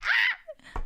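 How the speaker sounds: high delighted squeal. Retold or imitated. Retelling her reaction to the compliment with a squeal. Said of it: "And I was like".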